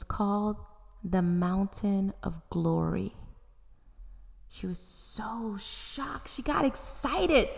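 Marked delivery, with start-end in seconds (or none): none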